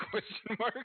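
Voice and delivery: high, laughing repeat; laughing question. Real, not acted. Player repeating the odd question, high and laughing.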